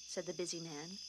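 usual speaking voice

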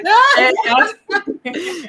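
a real, hearty burst of laughter. Real, not acted. Host loves the "yo soy loca" line, laughing aloud. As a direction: let the laugh rise high and ring out.

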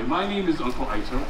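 normal talking voice

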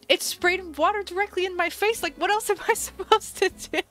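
high-pitched, incredulous retelling with laughter; laughing protest. Retold or imitated. Telling viewers about shower spraying her face, laughing protest. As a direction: protest helplessly, breaking into a laugh.

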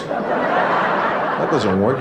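normal talking voice

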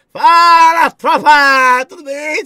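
high, loud hype call to the chat; loud greeting. Real, not acted. Streamer greeting his viewers, loud hype shout. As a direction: shout the hello to the whole crowd.

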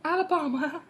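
exaggerated high-pitched mimicry; high mock echo. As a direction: squeak it back, rising at the end. Mocks the accent back in high voice.

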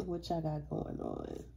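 ordinary talk

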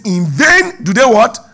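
the preacher demands the answer from the congregation, loudly; preaching at full force. Real, not acted. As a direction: hurl the question at the congregation. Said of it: "It says".

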